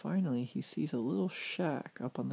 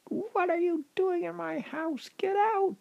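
very high, loud witch shriek; furious high-pitched witch voice; evil witch; 6 dB louder than usual. Acted. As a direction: screech at an intruder in your house. Evil witch yells at intruder.

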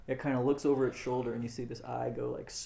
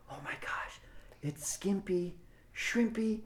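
awed crowd; 6 semitones higher, slower than usual. Acted. Game master voices everyone seeing the third warrior, hush building to excitement. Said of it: "and everyone says".

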